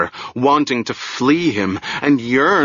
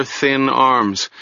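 ordinary delivery

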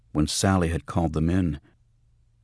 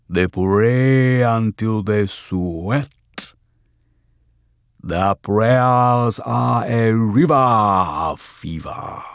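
slow, booming, higher prophetic voice; possessed voice; 5 semitones higher, 8 dB louder, much slower than usual. A narrator's reading. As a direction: intone it like a spirit prophesying. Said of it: "deep and slow and weirdly glutinous".

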